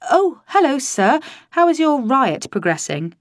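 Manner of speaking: high, loud, over-bright chirp; breezy greeting to boss. A narrator's reading. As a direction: feign casual cheer for the boss. Bluffing cheerily to the boss.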